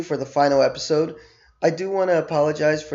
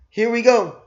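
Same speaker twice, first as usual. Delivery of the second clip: excited; 10 semitones higher, 6 dB louder than usual. Real, not acted. Kicking off the review.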